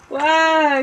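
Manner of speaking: short loud "Wah!" of a cry, high; mock wail. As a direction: wail like a hurt little kid. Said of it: "cried Sparkle".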